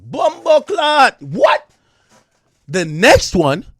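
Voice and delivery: loud, drawn-out cry of disbelief; shocked outburst. Real, not acted. Reacting to testimony about the next one, shocked outburst. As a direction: blurt it out in real shock, high and loud.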